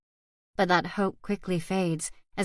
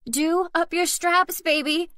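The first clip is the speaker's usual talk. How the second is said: higher, slowed urgent instruction; mild shouted instruction; urgent call; 10 semitones higher, 3 dB louder, slower than usual. A narrator's reading. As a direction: call back to the child over the noise. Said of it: "I shout".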